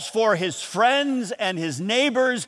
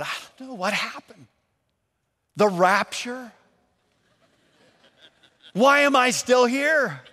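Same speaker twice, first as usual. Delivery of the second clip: panicked thought; 5 semitones higher than usual. Retold or imitated. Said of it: "I am a little bit panicked".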